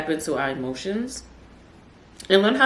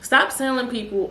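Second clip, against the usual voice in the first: ordinary delivery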